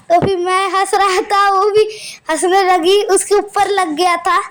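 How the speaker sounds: usual speaking voice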